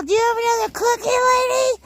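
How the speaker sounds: very high, fast, loud begging voice; voicing the horse. Voicing her horse begging for another cookie, very high and fast. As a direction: squeak out the horse's plea for a cookie. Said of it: "he's all".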